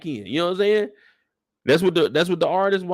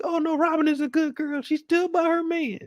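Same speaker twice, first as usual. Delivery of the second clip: much higher, mock defending voice; a high sing-song falsetto sticking up for Robin; mocking girly voice; 14 semitones higher than usual. Doubters in the room defending Robin, in a mocking high voice.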